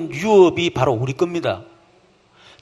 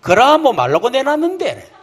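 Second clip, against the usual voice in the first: put-on cheeky kid retort; 6 semitones higher than usual. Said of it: "옛날에 내가 많이 썼던 수법".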